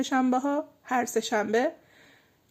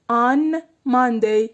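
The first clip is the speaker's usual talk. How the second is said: On said slow and louder; stress on On; 5 dB louder, much slower than usual. Shown as a demonstration. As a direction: lean on the word On, drawn out. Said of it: "یه دونه on آوردم". (تأکید کشیده روی On)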